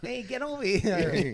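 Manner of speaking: half-landed cartoon impression; Peter Griffin. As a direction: try a cartoon voice, badly. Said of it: "I can't do Peter Griffin".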